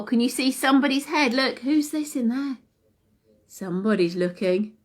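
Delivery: ordinary delivery, nothing marked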